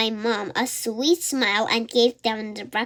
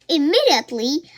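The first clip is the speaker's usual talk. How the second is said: ordinary delivery, nothing marked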